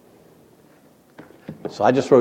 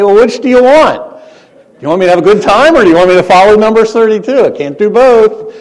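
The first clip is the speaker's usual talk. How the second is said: put-on much higher, louder, fast protesting teen; exasperated teenager; 8 semitones higher, 8 dB louder than usual. Teenage son protesting to his mom, high, loud and exasperated. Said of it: "I'd say".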